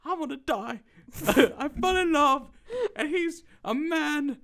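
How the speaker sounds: high, loud, slow tearful wail; hammed-up crying screech; mock hysterical wailing. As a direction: imitate a heartbroken woman bawling. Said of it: "She's like".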